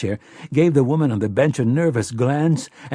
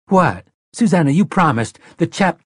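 alarmed protest; 7 semitones higher, 5 dB louder than usual. Acted.

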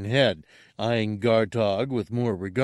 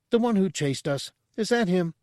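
woman, higher; 10 semitones higher than usual. Acted. Quella asks about guard.

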